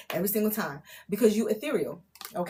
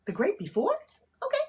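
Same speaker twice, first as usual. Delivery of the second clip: "The great before?" repeated higher, rising in disbelief; incredulous echo; 10 semitones higher, faster than usual. Real, not acted. Reader doubting her own channeled words, incredulous. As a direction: question your own odd phrase "The great before?". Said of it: "I don't even talk like that".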